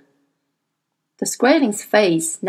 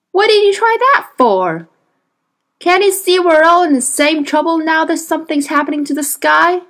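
higher, louder scolding of a captive; exasperated cowboy Lee; 9 semitones higher, 6 dB louder than usual. Acted. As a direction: demand answers, fed up. Lee voiced, angry at Skraeling.

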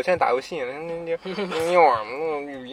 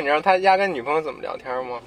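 usual speaking voice

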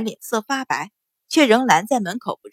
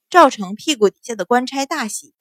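usual speaking voice

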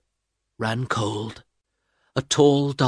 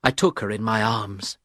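ordinary delivery

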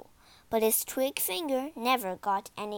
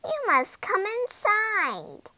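high, slow witchy invitation; old woman; 10 semitones higher, 4 dB louder, slower than usual. A narrator's reading. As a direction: lure them in with a drawn-out, high voice. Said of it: "said the old woman".

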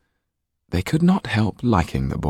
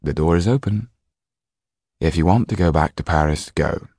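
put-on calm older man. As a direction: let him go calmly. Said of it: "said Henry Laxton".

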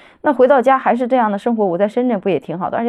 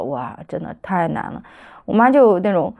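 usual speaking voice

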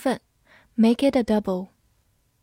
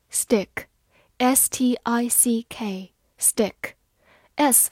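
very slow letters; drawn-out spelling drill; much slower than usual. Shown as a demonstration. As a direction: spell it out slowly. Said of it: "spelling aloud slowly".

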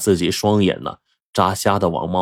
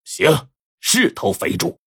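satisfied; 7 semitones higher, slower than usual. A narrator's reading. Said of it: "就满意的说".

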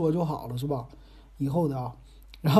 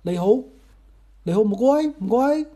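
higher, louder attempt at Cantonese phrases; 4 semitones higher, 5 dB louder than usual. Shown as a demonstration. Host showing off Guangzhou phrases, Cantonese attempt. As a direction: try out tourist Cantonese, pitched up. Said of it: "我也能整两句儿".

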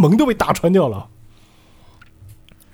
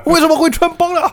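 very high, loud squawk of dismay; panicked disbelief; 5 dB louder than usual. Acted. Villain's disguise is exposed, panicked disbelief. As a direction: cry out like a villain found out.

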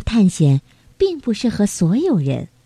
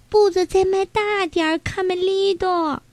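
light high call of a small animal urging a friend on; 焦急呼喊的小动物; 10 semitones higher than usual. Acted. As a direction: hurry him across, voice lifted. Character urges Camelido. (略带焦急的高声呼唤)

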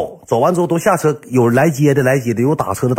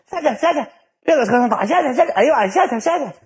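mock panicked pleading; 13 semitones higher, faster than usual. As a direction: imitate a flustered cry to get out. Acts out panic during fight in car. (模仿慌张尖声喊下去)

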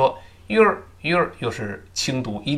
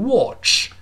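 British "watch" with a rounded "o" vowel; British pronunciation; 7 semitones higher than usual. Shown as a demonstration. Teacher shows how the British say it, British "watch". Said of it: "英国人读成watch".

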